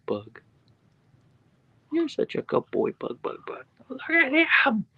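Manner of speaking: high, slow sing-song coo. Real, not acted. Owner cooing at her husky, high baby talk.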